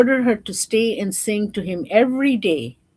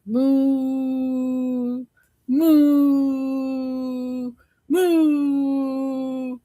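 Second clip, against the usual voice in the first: cow moo; 6 semitones higher, much slower than usual. Acted. Cow mooing.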